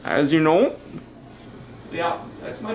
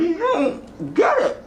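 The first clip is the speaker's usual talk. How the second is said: exasperated; 13 semitones higher, slower than usual. Acted. Arguing with cable company lady.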